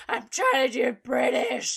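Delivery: loud, mock-tearful wail; mock whiny shout. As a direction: whine it like a frustrated friend. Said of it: "And you were like".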